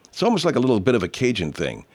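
ordinary talk, nothing marked

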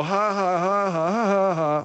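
high, spoken-out fake laugh; imitated mocking laugh. Showing Bud how he laughed, mocking imitation. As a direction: mimic a jeering ha-ha. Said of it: "You were mocking Margaret".

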